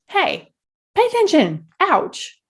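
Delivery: high, lightly voiced call-out; husband's signal. Husband flagging a hurtful remark, high mock call. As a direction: pipe it up high like a gentle warning.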